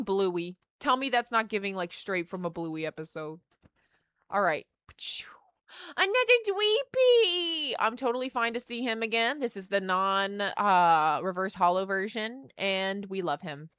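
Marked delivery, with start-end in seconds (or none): 6.0-7.7 high, slow delighted call; excited. Real, not acted. Spotting a repeat card, bright high call. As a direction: sing out the name happily.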